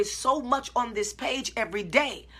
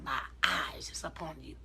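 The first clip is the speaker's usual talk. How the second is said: soft, lower whisper of God's promise; hushed whisper; 8 semitones lower, 5 dB quieter than usual. Acted. Preacher voicing God's promise to the congregation, soft whisper. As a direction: breathe it like a holy secret.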